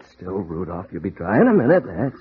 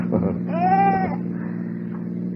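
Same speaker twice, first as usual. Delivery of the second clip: fond laugh; 4 dB quieter than usual. Real, not acted. Goat kisses him while driving.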